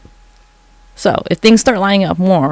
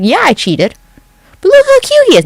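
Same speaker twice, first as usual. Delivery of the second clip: mocking singer; 5 dB louder than usual. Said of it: "Be like".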